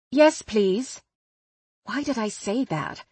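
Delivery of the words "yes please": fake British accent. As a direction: answer the driver in an unplanned posh-sounding British accent. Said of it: "In that accent?".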